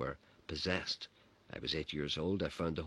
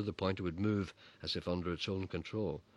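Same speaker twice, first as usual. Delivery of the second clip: ordinary delivery, nothing marked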